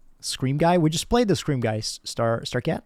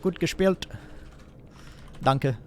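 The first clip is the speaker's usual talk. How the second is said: German phrase; 4 semitones higher, 4 dB quieter, slower than usual. Real, not acted. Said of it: "Now they're gonna think I'm German".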